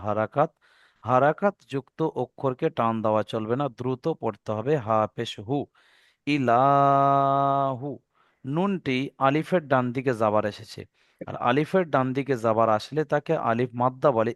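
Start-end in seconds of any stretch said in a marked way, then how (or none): 6.3-7.9 long, slow recitation with the vowel stretched; drawn-out Quranic reading. Shown as a demonstration. Teacher drawing out the word for students, slow recitation. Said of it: "এক আলিফ পরিমাণ টান দিয়ে পড়তে হবে".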